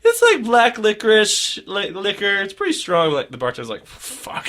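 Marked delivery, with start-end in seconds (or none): 0.0-3.1 bartender voice. Retold or imitated. Bartender voiced describing liquor. 3.9-4.5 breathy whispered fuck; whispered curse. Retold or imitated. Bartender muttering before shots.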